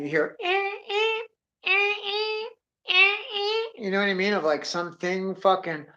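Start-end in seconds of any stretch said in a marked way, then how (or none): 0.4-3.8 high-pitched creaking wood noise; imitated wooden squeak. Storyteller setting an eerie Western scene, high imitated squeak. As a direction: squeak like old wood in the wind. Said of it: "squeaking in the wind".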